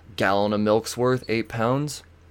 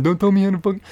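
higher, half-volume retold yell; mock angry yell; 11 semitones higher, 3 dB louder, much faster than usual. Driver replays his yell at supposed Texan, in mock anger.

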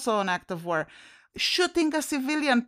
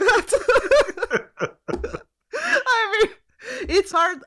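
words squeezed out through real laughter, much higher and louder; laughing; 14 semitones higher, 4 dB louder than usual. Real, not acted. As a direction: laugh while trying to talk. Laughing at the Cruz meme.

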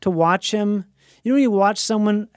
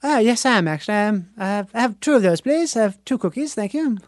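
put-on British accent, polite; playing English. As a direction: pretend to be English ordering cookies. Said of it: "But then I'll go".

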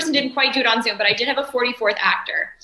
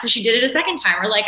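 usual speaking voice

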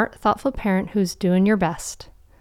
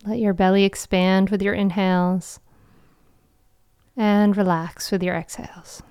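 guided meditation. Acted. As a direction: guide them into calm, with long pauses.

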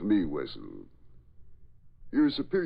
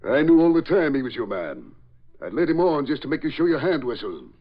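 louder, higher boast of having seen through the trick; triumphant gloating; 7 semitones higher, 7 dB louder than usual. Acted. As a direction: rub the trap in his face, loud and pleased. Patton gloating at Wessel.

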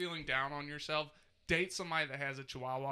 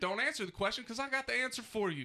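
heated rant; 6 semitones higher, 3 dB louder than usual. Real, not acted. Heated rant against chihuahuas.

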